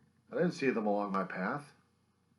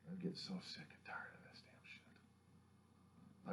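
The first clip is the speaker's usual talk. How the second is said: slow, whispered disgust; hushed exhausted complaint; whispered rant; 17 dB quieter, slower than usual. Real, not acted. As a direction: breathe it out slowly, fed up. Whispered then repeated rant.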